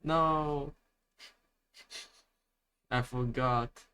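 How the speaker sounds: ordinary talk, nothing marked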